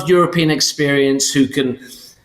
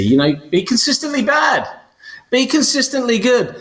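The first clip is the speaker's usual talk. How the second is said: higher, near-shouted stress on "bad" and "good"; emphatic contrast; 9 semitones higher than usual. Real, not acted. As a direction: punch "bad" then "good" to set them apart. Listing two contrasting options.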